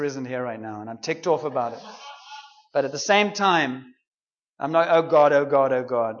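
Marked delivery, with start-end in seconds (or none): 4.9-6.2 low, strained panic; a hammed-up fretful cry; mock panic.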